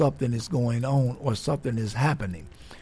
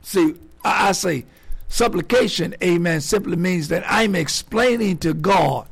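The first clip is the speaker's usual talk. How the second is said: loud, high, rising preacher's cadence; full-force preaching; 6 semitones higher, 6 dB louder than usual. Acted. Pastor preaching to believers, loud and rising. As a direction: preach it hard to the congregation.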